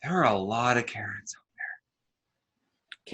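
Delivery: hushed breathy whisper. Real, not acted. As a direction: whisper it close to the mic.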